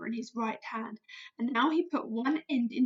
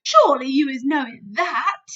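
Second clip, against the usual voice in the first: loud giant voice, firm; a big giant setting her straight; BFG, friendly giant; 11 dB louder than usual. Acted. BFG voice in story.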